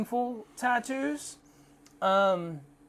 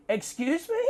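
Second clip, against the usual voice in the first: high, rising retold question; incredulous, questioning lilt; amused disbelief; 10 semitones higher than usual. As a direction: act out disbelief, pitched way up. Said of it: "I was laughing, like, thought she was joking".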